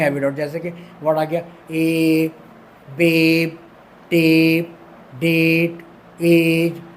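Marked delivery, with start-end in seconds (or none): 3.0-6.8 बेब टेप डेट एज with a long drawn ए; long e vowel. Shown as a demonstration. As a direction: sound out the long ए in each word. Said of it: "ए का sound आ रहा है, long करके".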